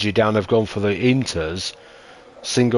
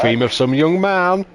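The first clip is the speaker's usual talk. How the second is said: higher and louder, hyped announcer call; excited call; 7 semitones higher, 4 dB louder than usual. Acted. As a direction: shout the nickname like a race caller. Commentator calls driver nickname again.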